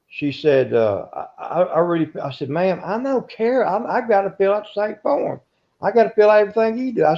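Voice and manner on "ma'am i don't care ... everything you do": pitched high, insisting, picking up speed at the end; pleading with a stubborn woman to just fill the form; exasperated insisting. Retold or imitated. Census taker pleading with a reluctant lady, higher and exasperated.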